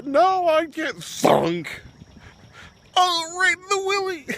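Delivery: very high, drawn-out mock cry of pain; hammed-up high, loud agony; comic falsetto yelp. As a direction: squeal it like a cartoon groin hit. Acts out skit of groin hit.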